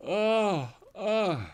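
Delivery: a big real yawn stretching out the oh. Real, not acted. Streamer yawning mid-chat, tired after long streams, yawning. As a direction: yawn right through the words. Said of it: "I'm yawning".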